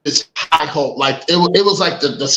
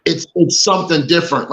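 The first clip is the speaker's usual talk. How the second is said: ordinary talk, nothing marked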